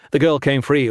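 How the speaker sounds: usual speaking voice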